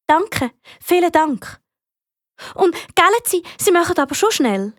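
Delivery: put-on high-pitched, pleading child's voice; thank, then press for speed; anxious child. Amy asking the fire brigade to hurry, anxious child.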